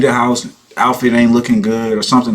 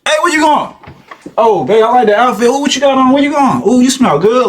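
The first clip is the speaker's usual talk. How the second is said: high, excited gushing; hammed-up admiring ooh-and-oh; imitated smitten boyfriend; 13 semitones higher, 3 dB louder than usual. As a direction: act out a boyfriend thrilled by her look. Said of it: "You walk in, he like".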